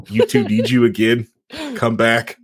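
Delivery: usual speaking voice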